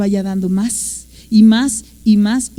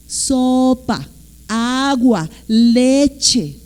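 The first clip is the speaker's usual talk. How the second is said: slow, stretched words, one by one; exaggerated parental diction; much slower than usual. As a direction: teach each word to a toddler, drawing out "sopa", "agua", "leche". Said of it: "hasta hacemos más dicción". (palabras lentas y alargadas, como papá enseñando a hablar)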